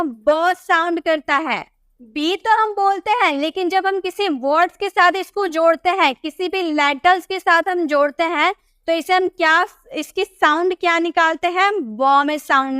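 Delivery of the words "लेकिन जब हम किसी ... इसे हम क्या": usual speaking voice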